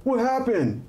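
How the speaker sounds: high, startled dude voice; bewildered dude. Acted. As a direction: call out in comic confusion. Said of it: "he goes".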